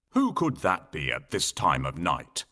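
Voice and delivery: put-on a gruff old king at night; a hint of a puzzled king. A narrator's reading. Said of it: "said the king".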